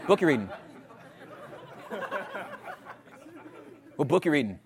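mock pushy questioner, repeating the question; lightly voiced nosy bro asking again and again; pushy dude asking. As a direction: needle him like an eager stranger. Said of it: "the dude that runs up on you".

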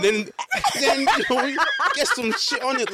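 high, giggling through the words; laughing. Real, not acted. Guest teasing co-host about bad hand jobs, laughing. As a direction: barely get it out through laughter. Mock advice amid group laughter.

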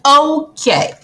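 sudden loud okay to startle; startling boo. Real, not acted. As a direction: blurt it out to make them jump. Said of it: "did I scare you? I was a little loud".

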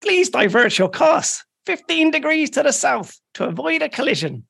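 high, stiff naval radio voice; radio officer. Acted. Irish officer radios the British ship, stiff naval voice. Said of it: "put on your best naval British voice".

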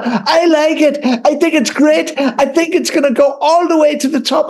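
high, sing-song judge voice; mock Louis Walsh.

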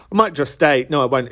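ordinary talk

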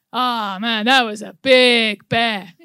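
lightly put-on American guy, drawn out; generic American accent. American guy walking over from the group, imitated accent. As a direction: imitate an American bystander, slow. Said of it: "guy with the generic American accent".

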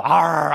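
mock dog growl in tug of war; playful growl. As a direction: growl like playing with a puppy. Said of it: "and they'll go".

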